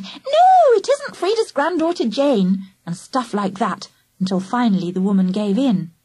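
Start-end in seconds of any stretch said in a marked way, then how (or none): 0.0-2.7 put-on child voice. A narrator's reading. Angela teasing in child voice.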